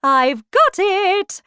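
high, loud, drawn-out triumph; happy cry. Acted. Queen catches her shawl, crying out happily. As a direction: cry it out in delight. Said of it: "she cried happily".

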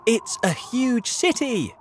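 high-pitched amazed exclamation; excited cry. A narrator's reading. Creatures discover a huge city below, excited cry. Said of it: "they cried".

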